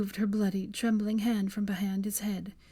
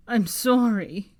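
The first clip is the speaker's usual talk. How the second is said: voice cracking yell; 2 semitones higher, 7 dB louder, slower than usual. Acted. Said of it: "he yelled, his voice cracking".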